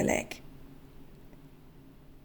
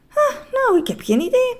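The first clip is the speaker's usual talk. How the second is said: character voice; 14 semitones higher than usual. Acted. Meneer Just answers, character voice.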